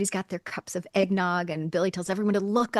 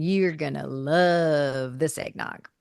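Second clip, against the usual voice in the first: slow, drawn-out teasing Jake impression; mock Jake; 4 semitones lower, much slower than usual. Jake teasing Billy about the eggnog, slow and sly. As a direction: stretch it out, slyly promising. Jake voiced in retold scene.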